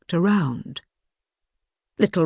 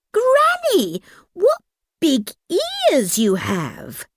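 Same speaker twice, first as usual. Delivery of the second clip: put-on little girl voice; 11 semitones higher than usual. Girl marvels at granny.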